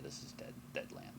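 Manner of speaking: hushed, whispered dread. Acted. DM describing barren land to players, whispered. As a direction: breathe it out low and ominous.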